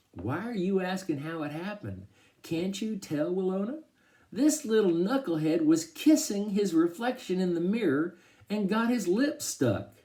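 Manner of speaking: high, incredulous teasing; amused incredulity. A narrator's reading. Dad explains the accident to Mama, high mocking disbelief. Dad mocks stuck son through laughter.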